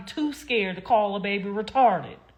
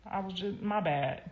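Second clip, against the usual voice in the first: mock sheepish; 9 dB quieter than usual. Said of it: "I'm gonna be like".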